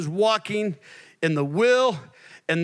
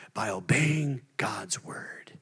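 hushed; 5 semitones lower, 5 dB quieter, much slower than usual. Acted.

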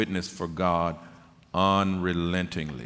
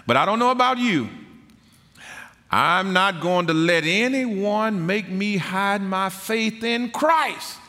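full-force preaching; 12 semitones higher, 6 dB louder than usual. Real, not acted. Preacher testifying of faith to the congregation, fervent and loud. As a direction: proclaim it to the congregation, stretching the key words.

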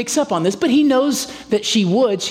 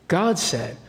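ordinary delivery

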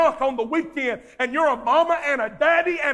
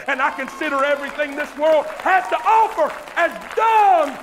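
fiery revival shout; full-force preaching; 6 semitones higher, 7 dB louder than usual. Acted. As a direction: proclaim it with all your might.

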